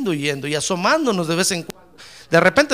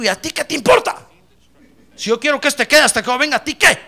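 higher, emphatic challenge; sharp rebuke; 7 semitones higher, 5 dB louder than usual. Acted. Jesus telling Peter to mind himself, emphatic rebuke. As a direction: put the nosy disciple in his place.

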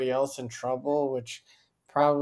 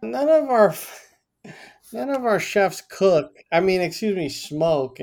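flustered laugh; 6 semitones higher, 6 dB louder than usual. Retold or imitated. Retold flustered reply, laughing.

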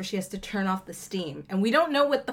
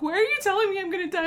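laughing; 10 semitones higher, much faster than usual. Retold or imitated. Friend quoted, laughing.

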